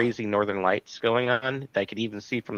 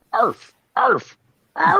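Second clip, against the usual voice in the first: much higher, louder parrot arfs; parrot arfing; 14 semitones higher, 5 dB louder, much slower than usual. Pretend. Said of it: "here's my naked parrot impression".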